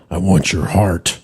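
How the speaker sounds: low, slow, menacing growl to the lamb; sinister monster voice. Pretend. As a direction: drop low and slow, like a villain craving a heart. Acting a lamb-eater's sinister line.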